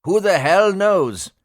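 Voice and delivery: high, loud, slow shout. Acted. Pilot shouting back into the klaxon silence. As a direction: yell it in panic. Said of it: "Singh shouted into the post klaxon".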